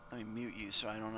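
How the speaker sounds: usual speaking voice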